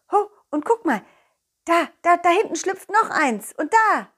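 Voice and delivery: high eager pointing out; thrilled little calls; excited. Acted. Dog excitedly pointing out butterflies.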